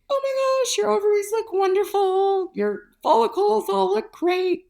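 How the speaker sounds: high, gushing imitation of the doctor; a mock-ecstatic high doctor voice; imitating thrilled doctor. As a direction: play him bubbling over with delight. Doctor thrilled by ovary scan.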